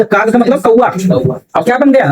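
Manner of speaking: normal talking voice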